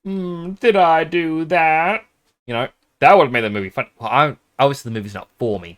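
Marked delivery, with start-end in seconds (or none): 0.0-2.0 high, slow nerdy "did I do that" voice; slow, high nerdy catchphrase voice; Urkel impression. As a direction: drag it out in a squeaky nerd voice. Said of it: "He sure said things like".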